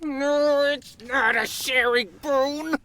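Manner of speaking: high, indignant cartoon dog. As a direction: protest like a possessive pup.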